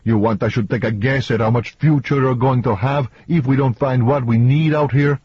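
acted angry man losing patience; menacing, heated man. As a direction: press it as a rising challenge. Voices Antonio threatening his colleague.

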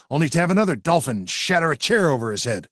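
ordinary delivery

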